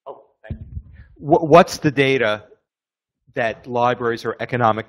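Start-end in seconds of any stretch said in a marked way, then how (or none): 1.2-4.9 clear, louder question into the microphone; raised, projected voice. Real, not acted. Attendee repeats burning question for the room, projected.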